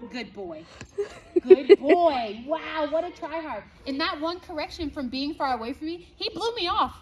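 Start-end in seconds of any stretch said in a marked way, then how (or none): 1.4-2.4 big loud burst of praise, same pitch. Real, not acted. Trainer loudly praising her dog on the walk, booming. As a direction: cheer the dog on loudly for trying hard.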